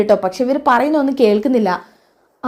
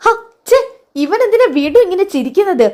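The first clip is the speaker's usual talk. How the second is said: annoyed outburst; 8 semitones higher than usual. Acted. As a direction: scold the laughing baby in a huff. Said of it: "Jalaja ദേഷ്യം പിടിക്കുന്നത്". (കുഞ്ഞിനോട് ദേഷ്യപ്പെട്ട്)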